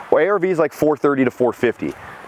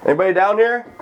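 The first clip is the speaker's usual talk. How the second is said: high call down the stairs; 9 semitones higher than usual. Real, not acted. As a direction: call down into the basement. Calling into basement.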